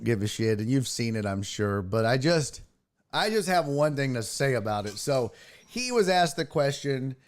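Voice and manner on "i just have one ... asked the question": ordinary talk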